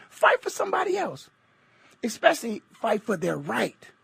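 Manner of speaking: usual speaking voice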